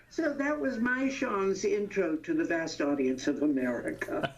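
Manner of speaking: ordinary talk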